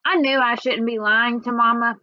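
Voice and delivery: usual speaking voice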